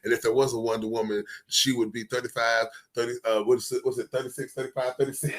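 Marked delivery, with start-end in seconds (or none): none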